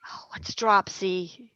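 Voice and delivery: soft whispered question; whispering. Real, not acted. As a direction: whisper it aside, slowly. Whispered aside.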